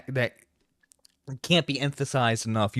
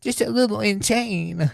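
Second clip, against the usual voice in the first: pitched way up, stammering like a flustered weeb; mock him with a high, fumbling voice; mocking whiny voice; 9 semitones higher than usual. Streamer parroting Jar's quote back, in a mocking higher voice.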